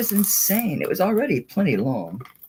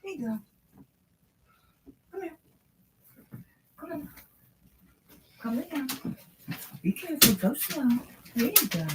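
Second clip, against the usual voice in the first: high, soft coaxing voice for a pet; high dog-talk voice; 13 semitones higher, 6 dB quieter, faster than usual. Real, not acted. Coaxing her dog to lie down, high soft baby talk.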